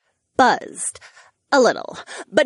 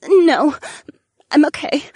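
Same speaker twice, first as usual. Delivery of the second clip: holding back tears; 5 semitones higher, 6 dB louder than usual. Acted. Vivian crying, then sniffling.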